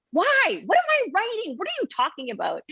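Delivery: bewildered, annoyed mom cutting in; startled exasperation; frustrated disbelief. Retold or imitated. Frustrated mother in retold morning.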